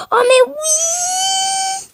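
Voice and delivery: exclamation aiguë et traînée; cri de joie. Real, not acted. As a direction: hurle sa joie, très haut. (cri aigu de victoire)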